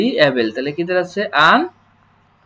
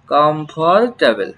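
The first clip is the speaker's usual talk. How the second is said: stretched slowly, syllable by syllable; slow teacher demo; slow model word; 2 semitones lower, much slower than usual. Shown as a demonstration. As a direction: draw the word out for the learners. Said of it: "teacher models the word".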